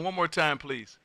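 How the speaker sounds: usual speaking voice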